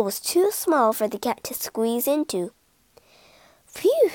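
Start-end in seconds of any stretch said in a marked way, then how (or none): 3.7-4.1 high sigh of relief; relieved phew. A narrator's reading. Rat safe in the hole, relieved phew. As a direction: breathe out the fear.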